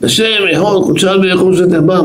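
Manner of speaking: high-pitched mock cry of prayer; retold shout. Elderly rabbi suddenly crying out a prayer at home, retold shout. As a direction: call it out high, imitating the rabbi. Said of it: "התחיל לצעוק".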